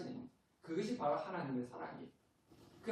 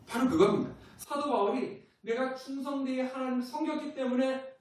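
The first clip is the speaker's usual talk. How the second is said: preach; 8 semitones higher, 6 dB louder than usual. Acted. Preaching climax.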